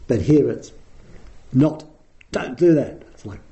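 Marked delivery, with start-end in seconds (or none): 1.5-3.0 "don't do that" a bit louder, with a firm fall; direct prohibition. Shown as a demonstration. Teacher shows the Buddha's blunt refusal, firm and direct. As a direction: forbid it plainly and directly. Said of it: "It's much more direct".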